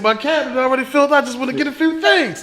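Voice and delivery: high, quick imitation of a friend; mock friend. Teasing a friend about his commissary haul, high quick mimicry. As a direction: pitch way up and rattle it off. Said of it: "He said".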